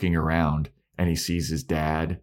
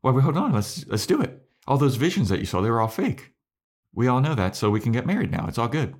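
high insistent voice; pleading Anya; 7 semitones higher, faster than usual. Retold or imitated. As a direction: talk him into it, bright and pushy. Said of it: "And Anya's like".

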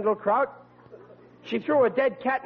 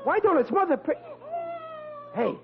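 normal talking voice

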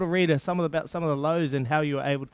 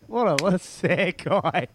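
ordinary talk

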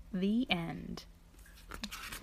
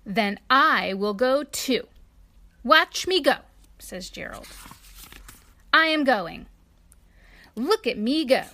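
louder, defiant announcement; huffy declaration; 3 semitones higher, 6 dB louder than usual. A narrator's reading. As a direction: show Piggy you are leaving too. Elephant stubbornly leaves too.

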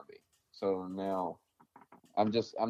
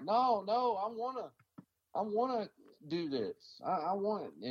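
pleading; 9 semitones higher than usual. Retold or imitated. Retold pleading to keep serving.

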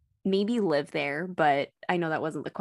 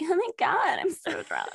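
high, giddy mock-drunk voice; tipsy drunk girl; 12 semitones higher than usual. To her friends, retelling one drink, mock drunk squeal. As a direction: play yourself tipsy after one drink. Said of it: "and I'm like".

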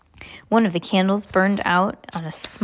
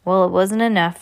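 ordinary delivery, nothing marked

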